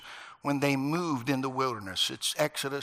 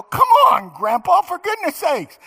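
high, loud whiny yell at grandpa; kid's protest; 13 dB louder than usual. Retold or imitated. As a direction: complain like a boy whose ear got nicked.

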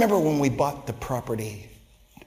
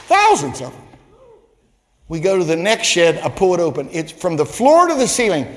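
louder and higher with every shed; building excitement; 6 semitones higher, 8 dB louder than usual. Real, not acted. Pastor building the shed story to a near shout for the church.

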